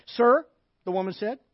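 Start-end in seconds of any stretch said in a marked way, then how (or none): 0.1-0.5 raised, loud, pointed "sir"; hint of sarcasm. Woman at the well answering Jesus, hint of sarcasm. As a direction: address him with a touch of sarcasm. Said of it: "with a hint of sarcasm in your voice".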